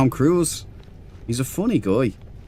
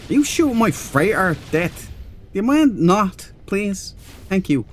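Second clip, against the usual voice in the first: much higher, pleading complaint at a player shooting his freighter; exasperated politeness, pitched way up; mock-polite protest; 10 semitones higher than usual. Real, not acted. Streamer asking another player to stop shooting his freighter, high and exasperated.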